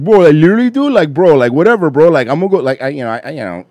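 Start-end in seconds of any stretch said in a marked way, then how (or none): 0.0-2.6 lightly hammed-up Miami Cuban accent; louder, bro-heavy Miami Cuban talk. Shown as a demonstration. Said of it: "I have the Miami Cuban".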